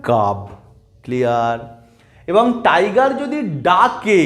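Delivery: normal talking voice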